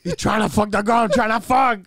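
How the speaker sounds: high, loud put-on dad voice; hammed-up dad rival; mock dad. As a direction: act out the dad outraged over the girl.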